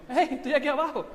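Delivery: high, loud call with a laugh. Acted. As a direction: shout it up with a chuckle. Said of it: "Así, más bien". (grito alegre hacia arriba)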